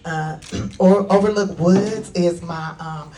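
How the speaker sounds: ordinary delivery, nothing marked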